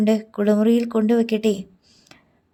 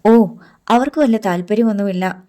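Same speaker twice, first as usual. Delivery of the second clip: ordinary delivery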